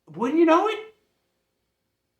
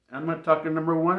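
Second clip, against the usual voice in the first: ordinary talk, nothing marked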